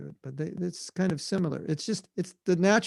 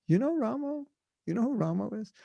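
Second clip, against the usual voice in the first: joking puzzlement; 6 semitones higher than usual. Retold or imitated. Acting out Prabhupada's joke.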